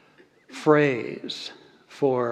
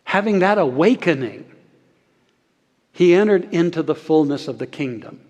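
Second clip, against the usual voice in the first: ordinary delivery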